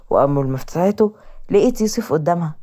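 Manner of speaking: plain reading voice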